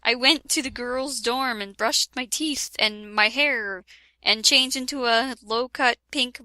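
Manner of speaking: usual speaking voice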